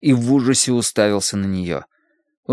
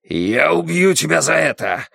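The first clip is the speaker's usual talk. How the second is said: angry, higher-pitched growl; growled threat; 5 semitones higher than usual. A narrator's reading. Husband attacking his wife, growling in rage. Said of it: "прорычал он".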